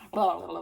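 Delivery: loud, sloppy tongue-flapping noise; mock slobbering. Mocking Jared kissing like a dog, sloppy slobber noise. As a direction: imitate someone slobbering all over a face. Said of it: "slobbering all over her".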